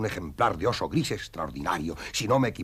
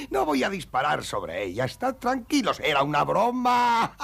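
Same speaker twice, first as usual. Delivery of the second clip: high, laughing reassurance; chuckling through the words; 7 semitones higher, 4 dB louder than usual. Acted. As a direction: laugh as you call it a joke. Laughing off the hunting threat.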